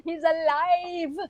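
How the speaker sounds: hammed-up Frankenstein proclamation; mad scientist. Mock mad scientist on camera.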